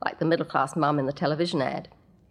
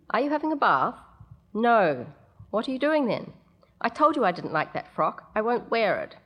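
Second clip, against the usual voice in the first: put-on child and mother exchange; 5 semitones higher than usual. Mother and child argue through door.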